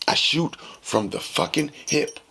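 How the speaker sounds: ordinary talk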